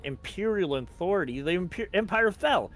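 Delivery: incredulous, pitch jumping up; confused disbelief. Acted. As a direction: question it in baffled disbelief. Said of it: "actually laughs. Not bravely, but just confuses".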